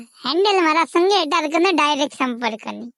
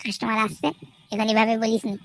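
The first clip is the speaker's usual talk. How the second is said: much softer, lower, gentle tone; soft polite voice; 7 semitones lower, 6 dB quieter than usual. Acted. Boss showing his friend polite speech, softly. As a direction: show him how to speak politely. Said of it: "এভাবে খুব সুন্দরভাবে কথা বলতে পারবো না".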